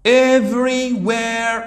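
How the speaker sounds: Everywhere said very slow and loud, every syllable clear; slow model of Everywhere for repeating; model pronunciation. Shown as a demonstration. Teacher drills Everywhere for students, slow and clear. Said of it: "teacher models word pronunciation".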